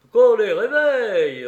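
loud, high-pitched shouted order; demanding shout. A narrator's reading. Mercenaries demanding that sleepers be woken, loud shout. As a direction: call it out as a rowdy demand.

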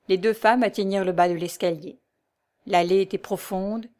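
ordinary delivery

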